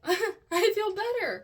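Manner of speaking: laughing it out, high and loud, slowly; relieved laughing. Real, not acted. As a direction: laugh with relief through the words.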